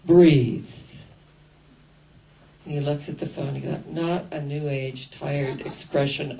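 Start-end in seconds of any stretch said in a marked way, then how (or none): 0.0-0.7 one loud slow drawn-out breathe; voice of God. Retold or imitated. God on the phone tells the man, breathe. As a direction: say breathe loud and long, falling. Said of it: "this voice says".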